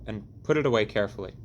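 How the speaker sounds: plain reading voice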